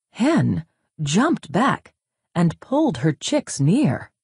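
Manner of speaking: ordinary delivery, nothing marked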